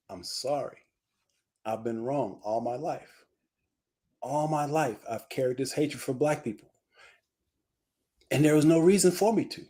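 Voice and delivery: low, subdued imitation of remorse; retold tearful confession. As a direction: quietly voice a repentant man's confession. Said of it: "Tears rolling down his face as he's saying this".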